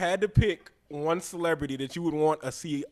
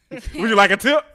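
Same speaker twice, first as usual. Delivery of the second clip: higher, louder put-on offer; mock attendant's question; 6 semitones higher, 10 dB louder than usual. Playing the attendant offering more service, loud mock-polite question. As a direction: ask it with exaggerated service politeness. Joking attendant role-play.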